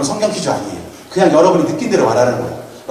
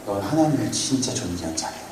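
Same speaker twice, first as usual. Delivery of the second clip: softer, earnest reassurance without the greasy tone; sincere encouragement; 6 semitones lower, 11 dB quieter than usual. Shown as a demonstration. Pastor to student Yebin, softly sincere and reassuring. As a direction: say it gently and sincerely to the girl. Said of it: "느끼하면 안 되는데".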